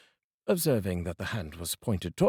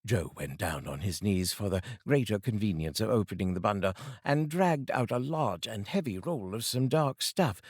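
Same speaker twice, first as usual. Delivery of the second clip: plain reading voice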